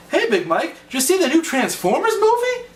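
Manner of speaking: mock-enthusiastic fan asking; eager fan. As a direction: pipe up like a keen fan, rising high at the end. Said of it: "people come up to me and they go".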